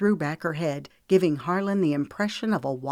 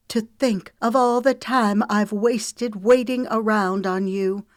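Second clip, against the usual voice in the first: rising bitter outburst, higher and louder; scorned woman turning on him; bitter anger; 5 semitones higher, 5 dB louder than usual. Acted. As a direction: let the resentment spill out. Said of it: "Her voice grew louder".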